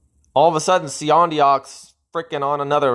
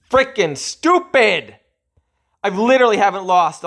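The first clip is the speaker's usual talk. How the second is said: angry outburst; 9 semitones higher, 4 dB louder than usual. Real, not acted. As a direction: stretch it out high in disgust. Gamer venting.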